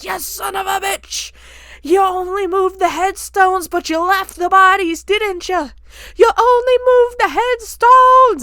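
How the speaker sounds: high, drawn-out imitation of an enraged man; furious yelling. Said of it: "he's just yelling about bodies and headstones".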